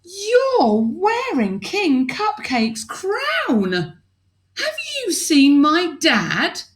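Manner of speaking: high, slow shocked princess voice. Acted. Princess seeing her father's crown on an alien, high and shocked. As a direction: gasp out the discovery slowly.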